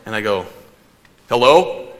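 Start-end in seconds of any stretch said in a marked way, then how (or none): 1.3-1.9 louder mock-tough hello; hammed-up manly phone answer; big man of the house. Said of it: "big man of the house".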